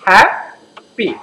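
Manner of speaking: Happy split slowly in two, pausing before পি; syllable by syllable. Shown as a demonstration. Teacher showing students how happy is pronounced, happy in two slow syllables. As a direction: break happy into two separate, drawn-out beats. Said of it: "করছি এভাবে হচ্ছে".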